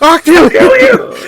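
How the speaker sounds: slow, loud, laughing mock threat. Real, not acted. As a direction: shout it while cracking up.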